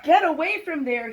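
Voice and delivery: dad calling out at kittens; father's shout. Acted. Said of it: "he cried".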